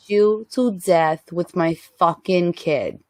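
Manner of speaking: hammed-up Brooklyn tough mom; Brooklyn accent. Reprising her set improv, hammed-up Brooklyn accent. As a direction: threaten like a Brooklyn street mother. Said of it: "she came back with her Brooklyn accent".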